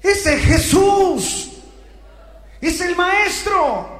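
high, drawn-out acted shout; cry of recognition. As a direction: call it out slowly in astonished recognition. Disciples recognize the one calling. (grito agudo y alargado de reconocimiento)

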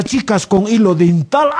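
normal talking voice

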